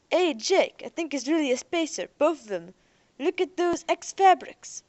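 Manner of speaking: loud, high, eager calling out; excited discovery. Acted. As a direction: call to Jake, thrilled by the find. Earther spots spacers.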